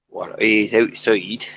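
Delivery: slow, put-on Yorkshire accent; weird Yorkshire accent. Streamer goofing about for his viewers, in a mock Yorkshire accent. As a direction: drag it out in a jokey Yorkshire accent. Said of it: "the weird Yorkshire accent".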